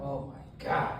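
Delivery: whispered "Oh my God" in mock panic; panicked whisper. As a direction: breathe it out, scared of getting caught. Panicked at cops arriving.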